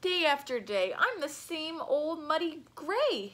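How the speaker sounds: hippo voice. Acted. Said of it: "Hippo explained".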